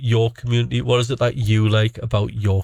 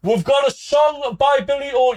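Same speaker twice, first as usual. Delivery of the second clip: much higher, playful; high silly voice; 12 semitones higher than usual. Shown as a demonstration. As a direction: squeak the announcement up high. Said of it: "I'll, uh, do it this way".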